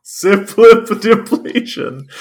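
slow, high words through giggles; laughing. Real, not acted. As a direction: barely get it out laughing. Garbled title through laughter.

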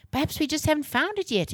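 high-pitched, eager exclamation; exclaiming. A narrator's reading. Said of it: "exclaimed Jake".

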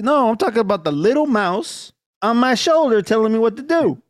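crazy man. Retold or imitated. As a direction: calmly insist the mouse is real, like a madman. Madman explains his imaginary mouse.

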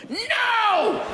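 sudden high yelled no; father's shout. Retold or imitated. Father yelling at his goth daughter at the cemetery, high mock shout. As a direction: bark it like an angry dad mid-argument. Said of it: "the father would just go".

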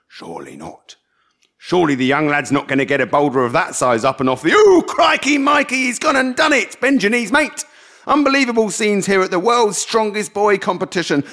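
hushed disbelief building to high excited shouts; mock commentator, from whisper to roar; sports commentary. A narrator's reading. Ian commentating on Shai lifting stones, excited mock sports commentary. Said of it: "Ian did a commentary".

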